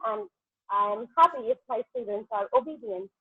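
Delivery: usual speaking voice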